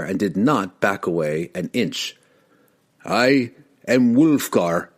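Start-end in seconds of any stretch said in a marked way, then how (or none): 3.0-4.9 slow, proud, ringing declaration; proud proclamation. Acted. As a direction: announce yourself proudly. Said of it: "he proclaimed proudly".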